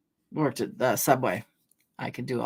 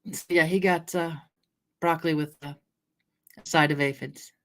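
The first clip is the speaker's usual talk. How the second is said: ordinary talk, nothing marked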